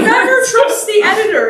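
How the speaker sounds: never shouted high and loud; emphatic shout. Real, not acted. Speaker echoes the guest's advice to the audience, shouting. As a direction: hammer home the warning about editors.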